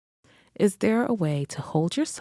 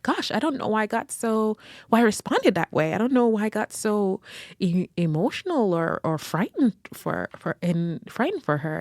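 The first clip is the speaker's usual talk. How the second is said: shaken; 6 semitones higher than usual. Retold or imitated. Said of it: "I kept saying out loud".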